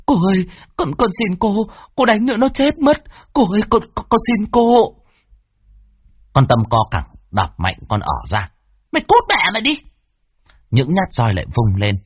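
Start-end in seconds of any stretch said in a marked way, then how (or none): 0.1-4.8 high, pleading desperate cries; servant begging. A narrator's reading. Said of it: "mà gào thét". 8.9-9.8 high, snarling shove-off; furious stepmother. A narrator's reading. Stepmother kicks servant away.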